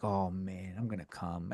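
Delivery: a secret, hushed thrill at the sauce; hushed mock ecstasy. Sauce fan to himself, a hushed mock rapture. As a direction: drop to a low, hushed moan of pleasure.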